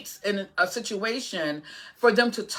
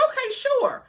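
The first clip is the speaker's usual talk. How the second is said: high agreeable reply. Retold or imitated. Mom agreeing to son's request.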